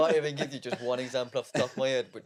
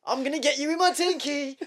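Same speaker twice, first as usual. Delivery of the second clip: put-on cheeky toddler; 9 semitones higher than usual. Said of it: "And I've heard".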